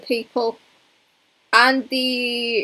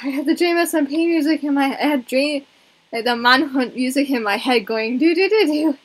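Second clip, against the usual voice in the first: usual speaking voice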